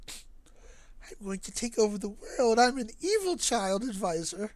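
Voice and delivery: put-on demonic child voice. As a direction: play a creepy evil six-year-old. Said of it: "sound like a demonic six-year-old".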